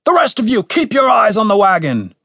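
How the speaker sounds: high shouted order; commander's shout. A narrator's reading. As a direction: call it out to the troops.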